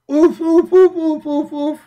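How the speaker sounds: high-pitched repeated "oof"; a string of high, derisive oofs; mock oofing. Mocks the article's claim.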